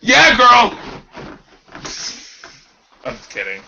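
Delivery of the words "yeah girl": high, loud cheer; excited shout. Real, not acted. To the co-host after a joke, big excited shout. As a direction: yell it like a hype friend.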